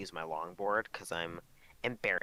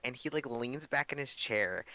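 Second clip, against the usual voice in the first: ordinary delivery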